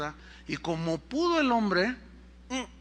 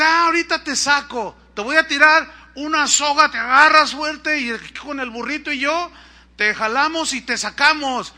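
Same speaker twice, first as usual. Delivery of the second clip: loud, higher acted holler; calling down; 7 semitones higher, 7 dB louder than usual. Villager to fallen man about the rope, calling down. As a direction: shout instructions to someone far below. Villager shouts rescue plan down.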